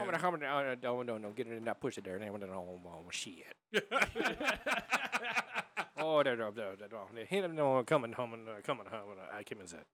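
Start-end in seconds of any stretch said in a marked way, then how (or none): 0.0-3.6 garbled backwoods Southern mumble; Boomhauer-like Creole jabber, trailing softer; Boomhauer-style backwoods. Acted. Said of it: "like the crazy Boomhauer and Creole". 6.0-9.1 jabbering mock Southern mumble; mock Creole mumbling, dropping away; Boomhauer-style backwoods. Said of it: "like the crazy Boomhauer and Creole".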